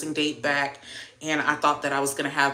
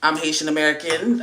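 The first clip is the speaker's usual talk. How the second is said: ordinary talk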